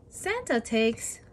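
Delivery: ordinary delivery, nothing marked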